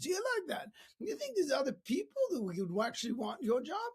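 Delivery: high-pitched put-on accent; hammed-up folksy questioning; lousy Zig Ziglar. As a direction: do a bad impression of a motivational speaker. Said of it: "That was a lousy impersonation of Zig Ziglar".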